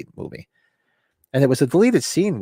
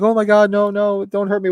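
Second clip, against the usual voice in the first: freaking out; 10 semitones higher, 4 dB louder than usual. Retold or imitated. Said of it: "he starts freaking out".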